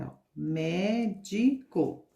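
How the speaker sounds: médico drawn out slowly, syllable by syllable; slow syllable demo. Shown as a demonstration. Teacher to children spelling out médico slowly. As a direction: spell the word out with your voice. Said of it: "trissílaba são as palavras que têm três sílabas".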